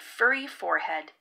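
usual speaking voice

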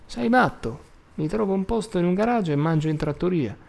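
high-pitched, indignant comeback; indignant retort. A narrator's reading. Al rejects his brother's teasing about marriage, indignant. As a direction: protest as if he were crazy.

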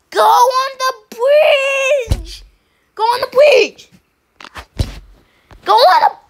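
high, slow frustrated cry; yelling. Real, not acted. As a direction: yell it at a stubborn teammate.

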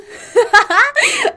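loud, high-pitched burst of laughter. Real, not acted. Laughing at own attempt at a voice, loud high laugh.

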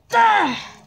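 sharp high yelp of fright; startled scream. Real, not acted. Gamer startled by a searcher, sharp yelp. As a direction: jump and yelp at the monster.

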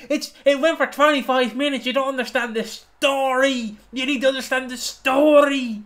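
exasperated high shout, drawing out "story"; hammed-up ranting shout; Ash yelling. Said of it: "I'm gonna see Ash being like".

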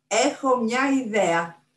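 slow, high, loud announcement; bright-idea announcement. A narrator's reading. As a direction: announce a sudden idea with relish. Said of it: "είπαν και οι δύο μαζί".

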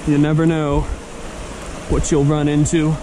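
ordinary talk, nothing marked

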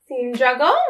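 ordinary delivery, nothing marked

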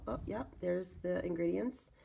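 usual speaking voice

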